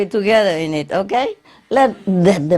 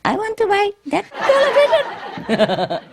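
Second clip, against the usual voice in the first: much higher put-on falsetto voice; changed voice. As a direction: disguise the voice way up high, half laughing. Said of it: "Changed the voice even".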